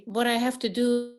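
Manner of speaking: ordinary talk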